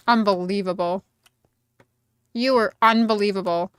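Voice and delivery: usual speaking voice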